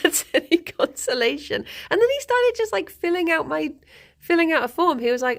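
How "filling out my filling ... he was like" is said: normal talking voice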